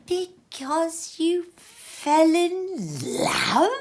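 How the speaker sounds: mock the very idea of love; mock growl. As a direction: Scrooge scorning the marriage. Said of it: "growled Scrooge".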